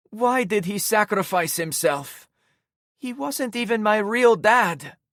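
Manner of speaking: put-on high, loud tearful girl voice; grieving girl. A narrator's reading. Em asking the narrator about her dad, high tearful. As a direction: ask it through tears.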